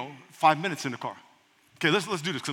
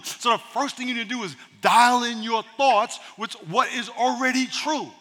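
ordinary talk, nothing marked

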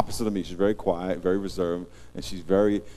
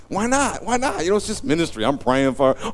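innocent protest; 9 semitones higher, 5 dB louder than usual. Retold or imitated.

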